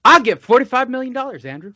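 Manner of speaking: high loud Wilder outburst; Deontay Wilder loud voice. Retold or imitated. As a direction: shout it like a hyped Wilder. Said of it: "when I do Deontay Wilder".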